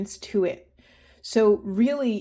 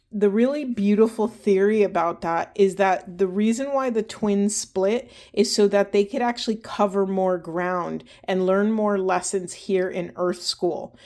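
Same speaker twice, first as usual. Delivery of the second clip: normal talking voice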